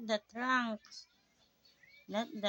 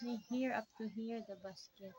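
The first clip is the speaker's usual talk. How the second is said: ordinary talk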